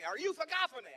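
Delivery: loud, high, demanding repeat; angry driver. Retold or imitated. Driver losing patience with the comic, angry loud demand. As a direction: bark it at him like he's getting mad. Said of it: "now he's kind of mad at me".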